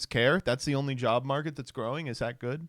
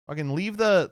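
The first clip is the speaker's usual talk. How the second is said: usual speaking voice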